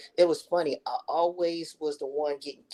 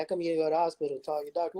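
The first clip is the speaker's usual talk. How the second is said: quick scolding question; 3 semitones higher, much faster than usual. Retold or imitated. Son scolding mother.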